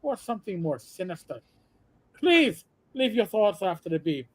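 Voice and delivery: comic Irish brogue, show-host patter; Irish accent. Retold or imitated. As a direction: pose the question as an Irish radio host. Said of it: "over-intoxicated Irish imagination".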